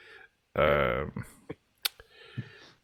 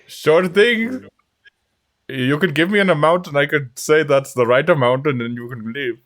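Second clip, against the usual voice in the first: put-on Indian accent; 3 semitones higher, 4 dB louder than usual. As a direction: play the merchant in a thick Indian accent.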